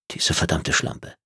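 geflüsterter Fluch, leiser; wütendes Flüstern. Acted. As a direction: zisch es zwischen den Zähnen hervor. Phil flucht in Gedanken. (Phil verflucht Tretzab geflüstert)

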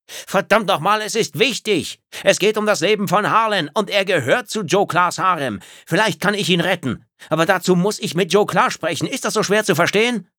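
viel höher und lauter, außer sich; verzweifeltes Schreien. Acted. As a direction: schrei sie an, als ginge es um Leben und Tod. Phil schreit Tretzab an. (Phil brüllt die Reptiloidin verzweifelt an)

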